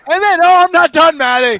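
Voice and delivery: don't let him stop you, you're on a roll; host cuts off his co-host to keep ranting, loud and worked up; fired-up interruption. Real, not acted. Cuts back in, heated rant continues.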